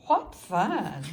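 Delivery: ordinary delivery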